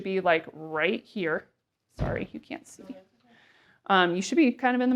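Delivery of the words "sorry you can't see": quick whispered apology off to the side; whispered aside. Real, not acted. Speaker apologizes to viewers who can't see the chart, whispering. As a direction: drop to a whisper, just an aside.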